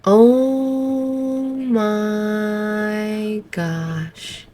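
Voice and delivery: long drawn-out shocked oh my gosh; stunned gasp. Real, not acted. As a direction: let the shock stretch the words out. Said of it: "I'm in shock".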